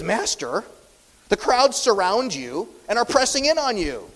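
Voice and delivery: a touch of reproach at the crowd; rebuking tone. Retold or imitated. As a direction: scold him a little for asking. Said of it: "almost a rebuking type of tone".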